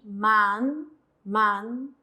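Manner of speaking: "man" ending on n, no h; pronunciación modelo. Shown as a demonstration. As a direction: model "man" twice, each a separate careful word. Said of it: "La nian se va a pronunciar aquí". (pronunciar "man" con n final, sin la h)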